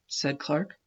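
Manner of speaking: plain reading voice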